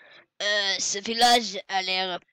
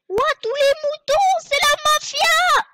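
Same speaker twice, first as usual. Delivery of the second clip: shrill put-on voice; 12 dB louder than usual. Cries out at mafia sheep.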